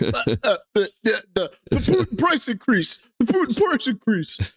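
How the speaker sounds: mock stammer, pitch rising on the repeats; stammering politician. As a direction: imitate a flustered politician fumbling his line. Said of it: "And he's like".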